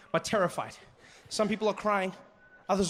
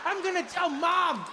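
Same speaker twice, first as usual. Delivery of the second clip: put-on angry child; 9 semitones higher than usual. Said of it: "this kid was like".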